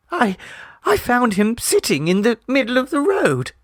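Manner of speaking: high, faltering weak voice; weakly. A narrator's reading. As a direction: falter it out weakly, near tears. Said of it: "said Rosemarie weakly".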